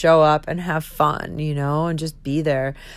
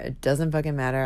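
ordinary delivery

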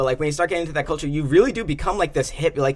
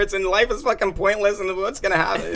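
loud, high freaked-out rant; panicked; 7 semitones higher, 9 dB louder than usual. Retold or imitated. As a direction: spiral into stoned panic. Retold stoned panic attack.

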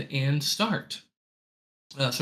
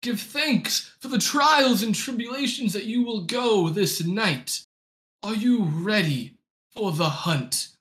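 high, solemn ritual chant voice; tribe leader; 8 semitones higher than usual. Acted. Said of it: "the tribe leader speaking to you".

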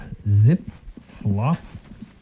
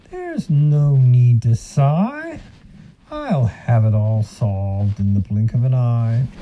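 sly silky fox; 3 semitones higher than usual. Acted. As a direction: soothe him like a sly fox. Fox reassures ox.

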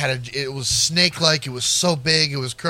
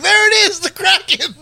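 much higher and louder, awestruck; a dad pointing out to sea in wonder; excited dad; 11 semitones higher, 4 dB louder than usual. Retold or imitated. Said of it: "Dad's at the beach like".

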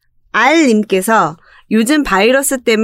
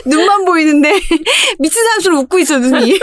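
talking through laughter; laughing; 9 semitones higher than usual. Real, not acted. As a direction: laugh while you speak. Laughing while telling funny story.

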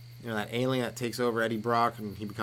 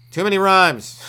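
high, very loud mock outburst; exasperated shout; 9 semitones higher, 12 dB louder, slower than usual. People sick of his freestyling, exasperated shout. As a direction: yell it like you are fed up.

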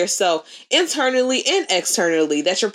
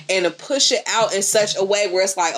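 normal talking voice

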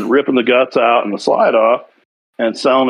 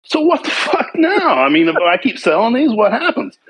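high, exasperated question; retelling a baffling call with a federal agent, exasperated; 10 semitones higher than usual. Retold or imitated. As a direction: demand an answer in disbelief.

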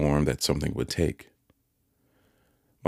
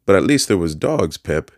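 Joe's eager plea; 4 semitones higher, 7 dB louder than usual. A narrator's reading. Joe pleading in story.

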